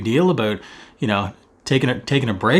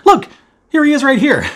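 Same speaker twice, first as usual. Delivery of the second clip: high, loud, thrilled; light up showing him off; delighted reveal; 10 semitones higher, 5 dB louder than usual. Real, not acted. Host showing his friend's photo, thrilled and loud.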